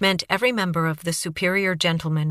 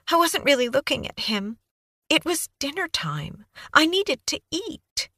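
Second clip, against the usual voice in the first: put-on high, breathless young woman babbling on; Kaylee's eager voice; 9 semitones higher than usual. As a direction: gush it out like a nervous, eager witness. Kaylee tearful, self-centred.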